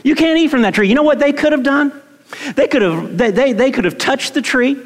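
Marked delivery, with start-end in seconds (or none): 0.0-0.9 God's command. Retold or imitated. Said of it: "He said".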